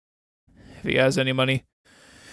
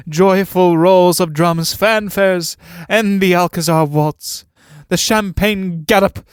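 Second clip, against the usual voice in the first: louder, higher, slow and triumphant; mock joyful fanfare; 4 semitones higher, 6 dB louder, slower than usual. Husband gloating over imagined funeral, mock triumphant. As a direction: announce it like a victory march. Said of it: "I always find myself intoning".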